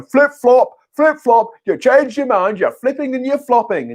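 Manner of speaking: high, sing-song flip-flop jeer; mocking taunt. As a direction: taunt like a heckler from the other side. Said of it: "you'll have the other side going".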